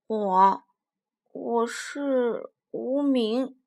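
slow, drawn-out hesitation; hesitant big dinosaur. Acted. Tyrant dinosaur asked his name by the little one, halting reply. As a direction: stretch each word as if searching for an answer.

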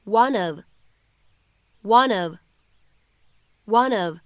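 one of linked as one-nof; linked reading. Shown as a demonstration. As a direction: run one into of with no break. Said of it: "可以读成". (one of 连读)

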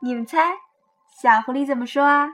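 plain reading voice

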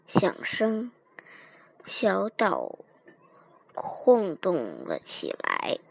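very soft, low, fading narration; slow, ominous hush; hushed suspense. A narrator's reading. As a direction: drop away to a near whisper. Ominous narration after the quake.

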